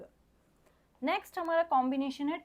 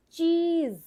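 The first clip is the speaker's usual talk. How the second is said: Cheese called out high and loud; teacher's call; 5 semitones higher, 7 dB louder, slower than usual. Shown as a demonstration. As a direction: announce cheese brightly to the class. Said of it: "teacher announces the blended word". (ऊँची आवाज़ में चीज़)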